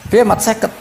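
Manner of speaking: high-pitched dismissive challenge; belittling objection. Acted. Musa belittles the fifty prayers, high incredulous protest. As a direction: question it as if it were absurd. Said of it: "Rodok ngeprekno lah cara Jawa".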